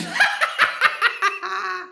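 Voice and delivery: high shrill-free villain cackle, way up in pitch; evil cackle. Acted. Cackle after lulz line.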